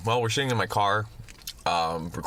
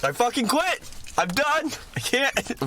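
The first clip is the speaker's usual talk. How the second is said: much higher, exasperated outburst; tilted lawyer; 14 semitones higher than usual. Pretend. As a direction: throw up your hands and quit, high. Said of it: "your lawyer's tilted".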